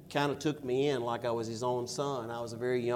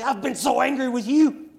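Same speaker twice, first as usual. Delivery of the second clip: mock anger; 10 semitones higher, 8 dB louder than usual. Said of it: "Why didn't he say".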